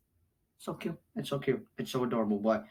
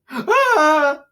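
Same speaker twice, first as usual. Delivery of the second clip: high, loud acted scream; mock gasp of horror; 13 semitones higher, 8 dB louder than usual. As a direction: imitate someone seeing his own death. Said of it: "saw his death, like".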